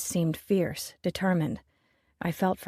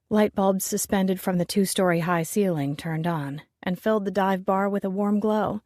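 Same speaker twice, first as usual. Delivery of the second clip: usual speaking voice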